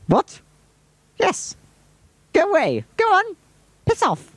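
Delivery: very high, short isolated bursts; put-on squeaky voice, each order on its own; high-pitched shooing. Filmer tells someone to go away, high put-on voice.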